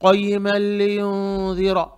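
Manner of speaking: क़य्यिमल लियुनज़िरा on a held note, long nasal युन, thick final रा; heavy ra recitation. Shown as a demonstration. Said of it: "रा को मोटा पढ़ेंगे".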